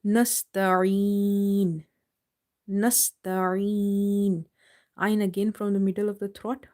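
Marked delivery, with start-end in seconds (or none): 0.1-4.5 nasta'een with the ee held long; nasta'een with a long ending; stretched ending. Shown as a demonstration. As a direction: hold the ee in nasta'een. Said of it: "we are stretching three".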